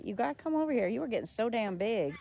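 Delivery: ordinary delivery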